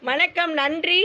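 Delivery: ordinary talk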